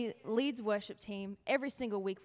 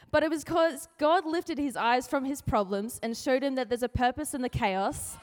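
ordinary talk

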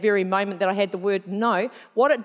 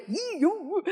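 higher, softer creepy squeal; weirdo voice; 8 semitones higher, 5 dB quieter than usual. Pretend. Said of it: "like a weirdo".

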